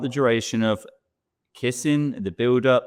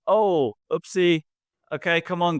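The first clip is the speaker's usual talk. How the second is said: light cheerful parent; 7 semitones higher than usual. Retold or imitated. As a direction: brush it off gently for a fallen child.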